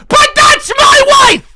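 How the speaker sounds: high, slow shout; outraged yell. Real, not acted. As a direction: yell to defend his wife. Jealous streamer yells at donor.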